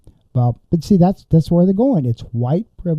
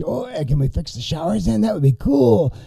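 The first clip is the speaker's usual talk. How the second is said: mock jokey voice, softer and higher; joking; 4 semitones higher, 4 dB quieter than usual. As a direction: imitate a guy cracking a sick joke. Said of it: "and then another one joked".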